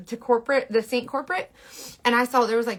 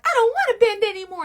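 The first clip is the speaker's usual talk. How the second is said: high, protesting little voice; whining bristles; 11 semitones higher, 4 dB louder than usual. Acted. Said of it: "they're like".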